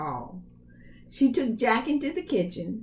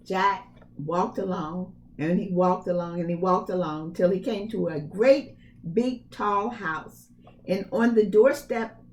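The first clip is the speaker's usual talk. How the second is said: plain reading voice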